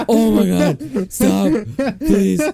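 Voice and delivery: high, loud mock-panicked plea through laughter; panicked protest. Storyteller fending off the kisser, mock-panicked. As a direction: cry out in hammed-up alarm, half laughing. Acting out her alarmed protest.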